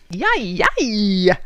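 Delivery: laughing howl; howling with laughter. Acted. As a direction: howl as if laughing while ducking. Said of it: "howled Rattlesnake, roaring with laughter".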